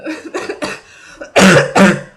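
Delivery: loud rough coughing; coughing fit. Real, not acted. Vlogger showing viewers her cough after the run, loud. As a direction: cough hard and long. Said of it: "as if to demonstrate".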